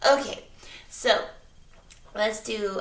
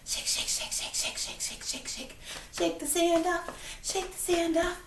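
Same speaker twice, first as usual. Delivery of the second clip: whispering; 7 semitones higher, 5 dB quieter than usual. Pretend. Whispered fading chant.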